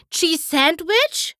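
high, slow, rising hopeful question; perking up at a friend's name; excited cry. A narrator's reading. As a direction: gasp out the name as if he might be here. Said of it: "schrie Pinkie Pie".

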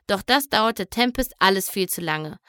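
usual speaking voice

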